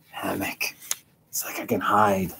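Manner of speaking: soft secretive whisper. Real, not acted. Hiding in the hammock with viewers, whispering.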